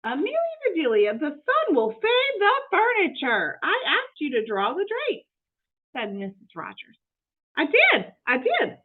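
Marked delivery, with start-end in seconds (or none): 0.0-5.3 higher, whiny fretting lady of the house; mildly distressed mistress scolding the maid; fretful Missus Rogers. Acted. 7.6-8.8 high, bright-eyed maid voice, lightly raised; eager, innocent housekeeper talking to herself; Amelia Bedelia voice. Acted.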